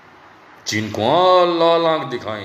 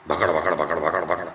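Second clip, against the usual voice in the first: lower, sneering babble sound; lower, softer mock chatter; mock blabber noise; 12 semitones lower, 3 dB quieter than usual. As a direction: imitate endless jabbering. Mocking Modi's constant blabber.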